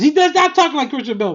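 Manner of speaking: loud, high-pitched protest; emphatic correction. Real, not acted. Reviewer correcting his own impression, loud and high. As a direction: pitch it way up and insist.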